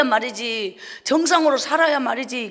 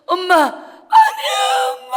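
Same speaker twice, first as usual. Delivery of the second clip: very high, loud, slow sobbing plea; crying out for mother; 10 dB louder, slower than usual. Real, not acted. Child crying as mother leaves. (엄마를 부르며 울부짖기)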